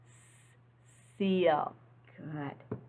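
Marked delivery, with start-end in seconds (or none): 0.1-1.7 a long hissing "S-" before "seal"; teacher drawing out "S-" for kids; hissed S sound. Shown as a demonstration. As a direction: stretch the "S-" into a hiss, then say "seal". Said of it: "Say it with me".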